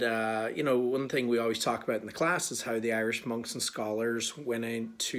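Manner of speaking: ordinary talk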